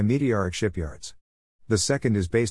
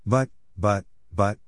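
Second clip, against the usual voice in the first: stammering; slower than usual. A narrator's reading. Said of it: "stammered McGonagall".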